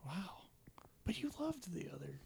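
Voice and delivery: soft, half-whispered surprise; hushed disbelief. Real, not acted. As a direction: let the voice drop away in disbelief. Hushed disbelief at a low score.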